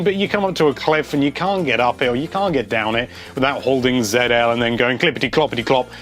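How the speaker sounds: ordinary talk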